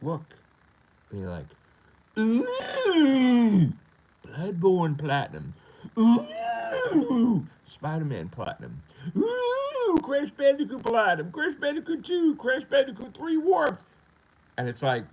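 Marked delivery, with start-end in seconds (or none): 2.2-13.8 high, loud squealed oohs; hammed-up mock awe in a shrieky high pitch; mock gushing. Critics ogling his platinum trophies, mock gushing.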